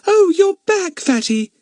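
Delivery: put-on high, bright-eyed girl greeting a friend; young girl voice. Betts greeting Fatty back at the shed, pleased girl voice. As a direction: lift into a young girl's voice, pleased.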